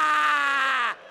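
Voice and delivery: very high, very loud shriek of fright; child's terrified scream. Retold or imitated. Little kid seeing the giant, screaming in terror. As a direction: shriek like a scared four-year-old.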